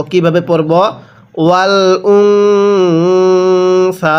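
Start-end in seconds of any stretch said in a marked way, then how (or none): 1.4-4.2 ওয়াল উনসা with the ন hummed long through the nose, very slow; nasal ghunnah recitation. Shown as a demonstration. Teacher demonstrating ghunnah on নুন সাকিন, slow nasal chant. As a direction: hold the nasal hum in উনসা. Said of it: "গুন্নাহ করে পড়ব".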